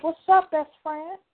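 high slow teasing hello; sweetly flirt with his friend; mock flirty best friend; flirty greeting. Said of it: "I'll be like".

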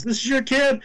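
high incredulous question; mock surprise. Carl asking the mother in high mock disbelief. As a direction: act amazed at the news. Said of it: "And Carl goes".

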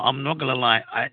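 ordinary delivery

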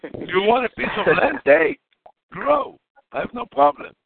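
high, emphatic, exasperated; animated protest. Real, not acted. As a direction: throw up your hands and wave it off. Disowning friend's weed plan.

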